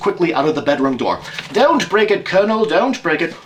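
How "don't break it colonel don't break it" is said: loud, high, mocking warning; spring out and call it across the room; louder teasing call at the colonel; sudden call. Holmes surprises the colonel.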